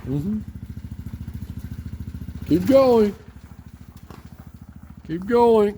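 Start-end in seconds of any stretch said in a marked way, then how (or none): 2.5-5.8 calling out. Real, not acted. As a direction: shout it across the yard. Calling out to driver.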